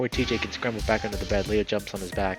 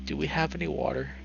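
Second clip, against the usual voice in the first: higher, weak sore-throat voice; 6 semitones higher than usual. A narrator's reading. T J asking for water, sore-throated. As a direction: ask as if your throat hurts. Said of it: "Sounds like he has extremely sore throat".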